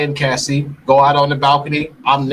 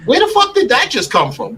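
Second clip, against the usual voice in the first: ordinary delivery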